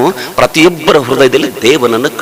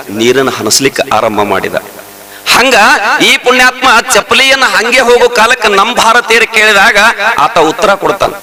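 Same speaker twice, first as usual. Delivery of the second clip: ordinary talk, nothing marked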